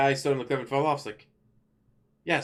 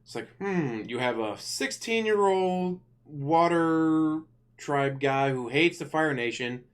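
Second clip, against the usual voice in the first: usual speaking voice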